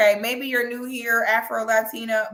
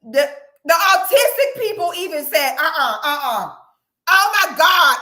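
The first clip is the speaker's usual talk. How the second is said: excited outrage; 5 semitones higher, 6 dB louder than usual. Real, not acted.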